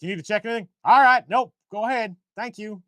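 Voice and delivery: put-on high-pitched Texas accent, loud then easing off. Texas official checking a traveler through, in a Texas accent. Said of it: "That was my Texas accent".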